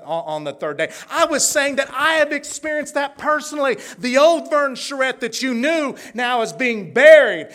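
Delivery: loud, high, ringing preacher's cry; building revival-preacher shout; preaching at full force. Acted. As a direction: preach it out to the whole church. Preaching climax of testimony.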